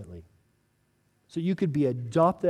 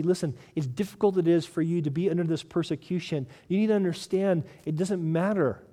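ordinary delivery

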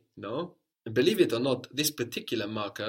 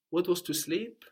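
ordinary delivery

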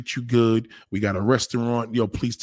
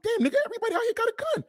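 amazed; 3 dB quieter, much faster than usual. Retold or imitated. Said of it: "I'm like".

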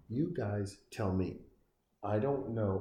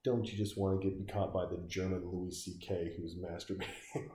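ordinary talk

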